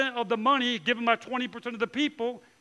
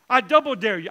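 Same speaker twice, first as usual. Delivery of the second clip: louder, daring challenge; bold dare; 8 dB louder than usual. Retold or imitated. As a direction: throw down the dare. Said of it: "is what God is saying".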